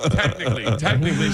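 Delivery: high, defensive backpedalling; mock defensive. [name] dodging being from Pennsylvania, mock defensive. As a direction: wriggle out of it, half laughing.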